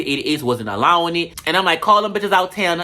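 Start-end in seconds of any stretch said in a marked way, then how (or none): none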